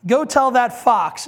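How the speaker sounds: slow, loud mocking reply; sarcastic. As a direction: toss it back at Herod with scorn. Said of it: "I want you to hear the sarcasm in this".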